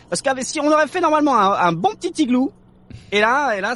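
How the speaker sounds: high, fast, wound-up rant; too much caffeine. Real, not acted. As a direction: rattle it off like you're over-caffeinated.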